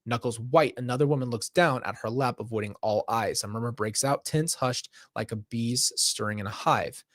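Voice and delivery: ordinary delivery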